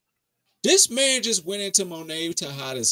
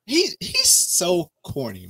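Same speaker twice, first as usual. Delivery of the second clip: slow exasperated drawl; 4 semitones higher, slower than usual. Real, not acted. Complaining about the corny actor.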